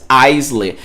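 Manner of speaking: Aisley said as AIS-ley, with an s and a final ley, like it's spelled in Portuguese; Aisley pronounced letter by letter; wrong Brazilian pronunciation. Shown as a demonstration. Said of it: "minha mente tá no português".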